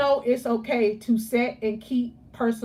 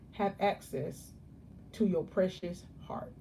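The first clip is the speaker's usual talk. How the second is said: softly emphatic; 3 semitones lower, 9 dB quieter than usual. Acted. Coach softens on key point.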